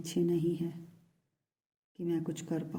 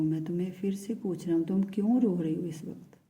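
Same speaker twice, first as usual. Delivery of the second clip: ordinary delivery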